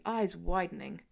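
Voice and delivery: usual speaking voice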